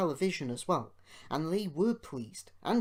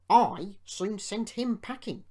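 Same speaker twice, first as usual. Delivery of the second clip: proud, slow boast, higher and louder; boastful; 7 semitones higher, 7 dB louder than usual. A narrator's reading. James boasting to Duncan about diesels, proud. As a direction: swagger through the brag. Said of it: "James was boastful".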